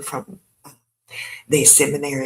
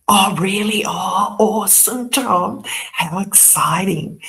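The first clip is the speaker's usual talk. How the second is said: higher-pitched, hammed-up fake enthusiasm; mock excitement; 5 semitones higher than usual. Mocking Tom's talk of the World Cup groups, mock excitement. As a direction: feign being thrilled for Tom. Acting out bored listeners' mock interest.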